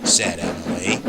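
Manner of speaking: slow, singsong storybook narration; Michael Brandon. Acted. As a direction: stretch the words like a train-story narrator. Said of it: "I am Michael Brandon".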